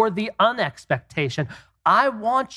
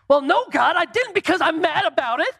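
petulant reply; 11 semitones higher than usual. Pretend. Whining back at God.

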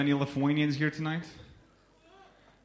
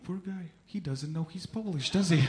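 mock pity; 6 semitones higher than usual. British guy walking away from the Lithuanian, mock pity. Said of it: "looking at me like".

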